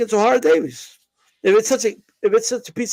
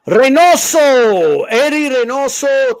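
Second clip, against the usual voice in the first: slow, louder, drawn-out ring-announcer build-up; dramatic announcement; 4 semitones higher, 5 dB louder, much slower than usual. Acted. Host unveiling the big story to listeners, announcer-style build-up. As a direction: stretch the name out like introducing a main event.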